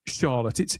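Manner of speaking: usual speaking voice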